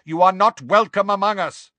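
high, loud proclamation; calling out. A narrator's reading. Centaur warning off a giant, loud commanding call. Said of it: "called Megorian".

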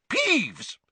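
high-pitched irate call; angry yell. A narrator's reading. Angry teacher calls to the troublemaking poltergeist, angry yell. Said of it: "yelled an angry voice".

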